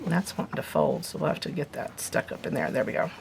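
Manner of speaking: muttered aside. Real, not acted. Muttering to herself while working.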